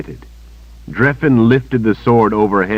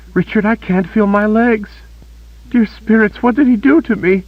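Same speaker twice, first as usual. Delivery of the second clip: much higher and louder, quivering with panic; panicked; 4 dB louder than usual. A narrator's reading. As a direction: cry out to Richard in terror. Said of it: "Her voice quivered with panic".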